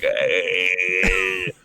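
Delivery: long, drawn-out held yell; drawn-out "heyyy" war cry; imitated battle cry. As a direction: stretch it into a sustained charging cry. Imitates cry while charging at her.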